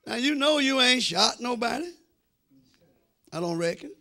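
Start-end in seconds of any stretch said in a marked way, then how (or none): none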